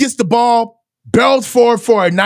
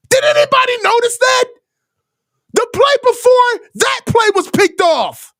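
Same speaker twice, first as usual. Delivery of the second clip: high-pitched outraged yelling at the screen; real fan meltdown, voice pitched way up; 12 semitones higher than usual. Real, not acted. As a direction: yell at the TV in disbelief at the throw. Fan raging at interception on TV.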